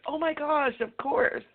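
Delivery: high, bright oh my gosh; retold realization. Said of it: "it was like".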